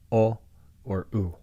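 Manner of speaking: ordinary talk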